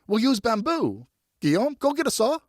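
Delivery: bossy and thrilled; boy barking orders; excited orders. Acted.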